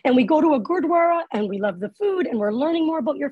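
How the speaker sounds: a hint of a keen mom rattling off facts; eager parents. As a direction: pile on the enthusiasm. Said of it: "My parents were like".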